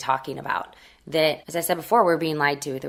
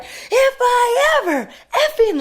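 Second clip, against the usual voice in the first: much higher, much louder, slow laughing mock shout; hammed-up furious rant, half laughing; furious mother yelling; 7 dB louder, much slower than usual. Mother cursing at the agent, a big slow laughing mock shout.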